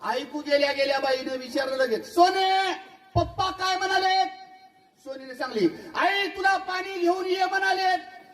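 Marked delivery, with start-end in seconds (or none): 2.1-4.4 high, slow calling wife; high drawn-out question. Retold or imitated. Wife asks daughter. 5.9-8.2 put-on high, slow girl's call; high shouted relay; daughter relaying.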